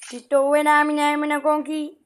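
loud nonsense shout at the screen; gibberish yell. Pretend. Yelling gibberish at friend during game chaos. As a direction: belt out the made-up words.

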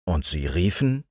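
ordinary delivery

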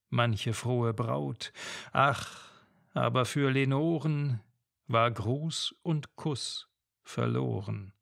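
plain reading voice

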